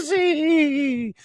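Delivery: high, drawn-out zany cry. Acted. Host warning that tonight will be chaotic, zany high drawl. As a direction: stretch it out high and silly. Comic drawn-out hype word.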